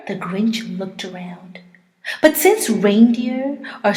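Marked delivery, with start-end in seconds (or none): 0.1-1.6 whispered, softer, quick. A narrator's reading. Narrator to listeners, sneaky whisper. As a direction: hush it like a secret.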